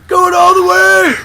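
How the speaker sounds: high, full-out whooping yell; hammed-up victory scream; rowdy celebrating shout. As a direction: whoop like a riled-up sports fan. Plays cop celebrating with gunfire.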